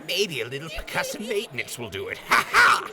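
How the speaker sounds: high loud gleeful laugh; gleeful cackle. Acted. Raider about to whack the robot, high gleeful laughing. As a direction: crow with delight.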